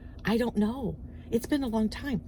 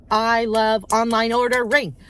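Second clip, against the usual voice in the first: slow, louder, every word leaned on; emphatic declaration; 6 dB louder than usual. Real, not acted. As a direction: declare it for the record. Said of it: "I want to go on record".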